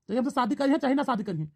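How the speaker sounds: ordinary delivery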